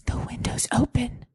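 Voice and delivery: whispered warning, unvoiced. Woman warning her date the window is open, whispered. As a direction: hiss a secret warning.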